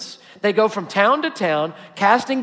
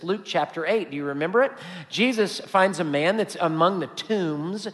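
normal talking voice

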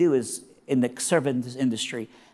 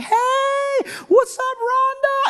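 put-on very high, drawn-out teen holler; gleeful high whoop, stretched out; shouted greeting; 4 dB louder, much slower than usual. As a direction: mimic excited kids hollering hello. Youth group greets Rhonda in lobby.

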